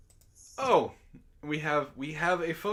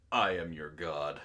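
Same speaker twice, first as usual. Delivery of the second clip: pitched way lower with an odd timbre; deep god voice; 10 semitones lower than usual. Acted. Streamer voices the meme's god line, low and grand. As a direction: boom it like a deity. Reading a meme quote in a voice.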